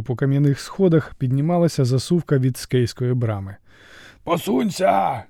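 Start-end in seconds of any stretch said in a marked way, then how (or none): 4.2-5.2 трохи вищий, повільний окрик; крикни стиха; тихий крик. A narrator's reading. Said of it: "тихо крикнув засув". (засув стиха кричить на десятника)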